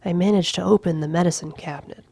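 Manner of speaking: ordinary delivery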